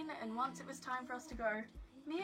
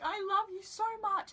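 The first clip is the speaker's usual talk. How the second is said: high, louder mock wail; retold tearful breakdown; 11 semitones higher, 6 dB louder than usual. Crying fan declaring love to idols, mock wailing. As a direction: act out gushing through tears, pitched way up. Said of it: "I'm crying, I'm having a breakdown".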